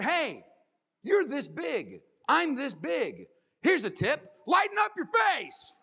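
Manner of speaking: put-on a touch of a child scolding her dad; daughter's advice. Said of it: "She said".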